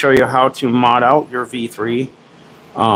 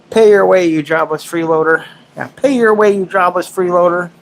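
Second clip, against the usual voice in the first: mock scolding; 6 semitones higher than usual. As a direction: playfully order a freeloader to earn their keep. Mock scolding of dog, repeated.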